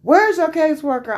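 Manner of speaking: high, loud, frantic demand; hysterical demand. Retold or imitated. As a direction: imitate the doctor losing it. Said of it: "he was hysterical".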